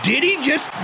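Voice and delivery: surprised. Real, not acted. Ball flies toward wall.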